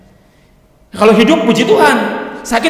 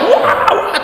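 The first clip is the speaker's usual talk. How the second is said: mock panic cries; 13 semitones higher, 3 dB quieter than usual. Retelling screaming plane passengers.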